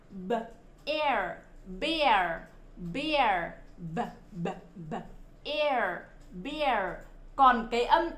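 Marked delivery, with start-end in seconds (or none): none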